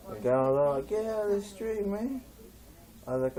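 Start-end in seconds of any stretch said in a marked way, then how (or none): 0.9-2.2 higher-pitched imitation of an irked driver; mock annoyed yell. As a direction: call it out, pitched up. Driver yelling at them, retold.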